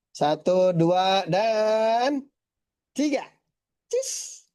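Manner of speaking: rising, cheerful call of numbers; excited countdown. Real, not acted. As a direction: count the group in for the photo. Photo countdown, cheese call.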